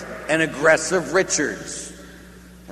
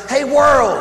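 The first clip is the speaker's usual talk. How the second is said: higher, louder call to the whole crowd; announcing; 9 semitones higher, 7 dB louder than usual. Pretend. The Holy Father presents Joe to the world, calling out loud. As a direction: proclaim it to the world.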